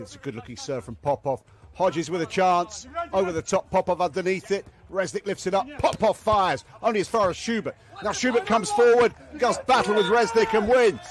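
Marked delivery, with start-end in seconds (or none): 1.7-11.0 builds from keen to a full shout as the rally peaks; rising rally excitement. Real, not acted. Commentator calling a long rally, building to a shout. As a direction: climb with every touch until you're yelling.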